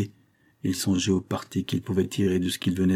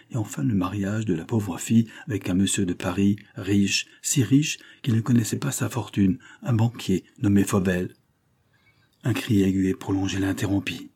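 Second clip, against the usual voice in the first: plain reading voice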